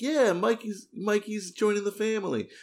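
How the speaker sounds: hammed-up welcoming cheer; playing the family, happily announcing Mikey; mock family cheer. Family cheering Michael joining.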